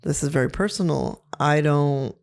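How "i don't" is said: normal talking voice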